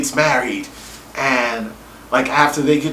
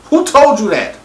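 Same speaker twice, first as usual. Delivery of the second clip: indignant mother; 6 semitones higher, 4 dB louder than usual. Retold or imitated. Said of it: "His mother's like".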